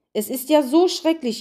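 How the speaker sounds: high-pitched whiny lament; wailing. A narrator's reading. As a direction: moan it like a woeful snowman. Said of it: "jammerte der Schneemann".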